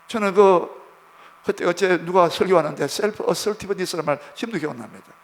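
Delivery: ordinary talk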